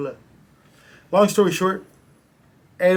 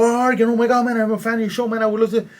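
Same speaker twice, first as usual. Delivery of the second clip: higher, louder starstruck gush; gushing fan; 7 semitones higher, 5 dB louder, faster than usual. Pretend. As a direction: play an overexcited fan. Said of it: "I'm gonna stop and go".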